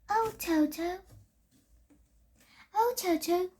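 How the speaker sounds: soft, lifted calls of Oh, Toto; a quiet, wistful Dorothy, lightly voiced; soft stage line. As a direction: Milani softly opening the play as Dorothy. Milani acting Dorothy on stage.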